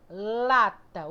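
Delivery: "Lata" with a loud, stretched LA; LA-ta, strong first beat, light ending; stress on LA. Shown as a demonstration. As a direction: lean hard on the first syllable of "Lata". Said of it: "O lá sai com mais força".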